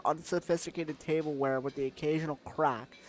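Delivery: normal talking voice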